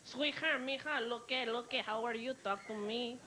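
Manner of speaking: hammed-up Mexican catcaller; Mexican accent. Mexican man hitting on tourist, mock Mexican accent. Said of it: "Every Mexican guy was hitting on me".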